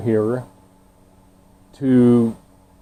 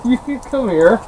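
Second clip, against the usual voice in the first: dog-talk voice; 10 semitones higher than usual. Real, not acted. Coaxing the dog, high.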